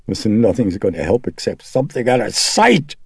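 loud, high, quavery outburst. A narrator's reading. Chris erupting at the priest, loud and quavery. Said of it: "in a voice that was loud and quavery".

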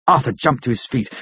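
ordinary delivery

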